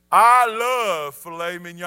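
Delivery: booming, drawn-out proclamation; loud, relished declaration. Real, not acted. Preacher confesses his favorite food to congregation, booming. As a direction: belt it out, stretching the first words.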